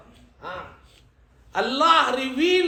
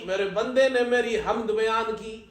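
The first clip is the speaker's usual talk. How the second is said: normal talking voice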